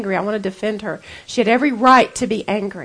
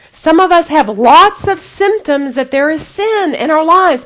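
fervent sermon, louder and higher; preaching; 5 semitones higher, 6 dB louder than usual. Acted. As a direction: preach with rising force. Preaching on sin to the ladies.

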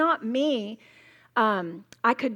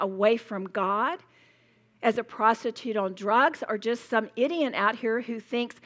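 ordinary delivery

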